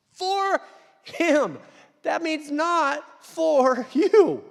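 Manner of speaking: high, loud, very slow words; emphatic preaching. Real, not acted. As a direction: hammer each word home. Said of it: "preaching climax".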